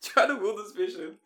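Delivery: loud, high retold pleading; exasperated protest. Angler retelling his answer to screaming friends, exasperated shout. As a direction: shout back under pressure. Retold quarrel while reeling in fish.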